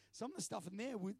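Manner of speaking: ordinary talk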